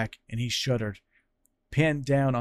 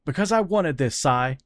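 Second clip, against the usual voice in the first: higher, louder defiant shout; shouting back; 5 semitones higher, 5 dB louder than usual. Acted. Fran answering Cyril's anger, shouting back. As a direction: throw it back at him. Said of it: "Fran shouted back".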